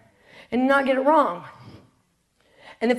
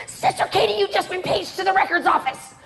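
put-on breathless girl messenger; 6 semitones higher than usual. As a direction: announce the page, urgent and light. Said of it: "a girl comes running in".

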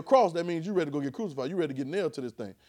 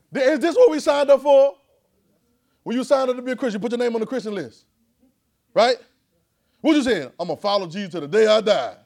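high, loud, pushing the crowd to answer; loud preaching call; 6 semitones higher, 10 dB louder than usual. Real, not acted. Preacher calling the congregation to raise hands, loud and high. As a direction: call out to the room and demand hands.

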